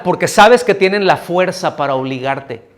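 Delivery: ordinary delivery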